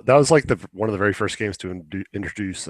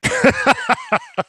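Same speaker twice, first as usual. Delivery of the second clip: burst of high laughter; 4 dB louder than usual. Real, not acted. Laughs at the Minecraft movie.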